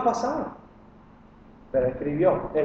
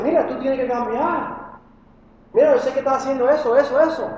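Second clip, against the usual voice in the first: confronting lecture; 6 semitones higher than usual. Pretend. Imagined harsh confrontation.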